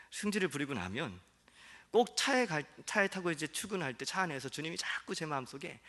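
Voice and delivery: normal talking voice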